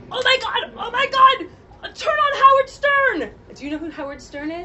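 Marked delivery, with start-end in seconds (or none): 0.1-3.3 very high, loud, frantic urging; frantic husband. Retold or imitated. Husband phoning his wife in a frantic rush. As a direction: panic and urge her to turn it on.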